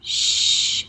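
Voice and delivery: "Shh" as a long hushing hiss; sh sound. Shown as a demonstration. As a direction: hush like quieting a room, no voice. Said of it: "they say".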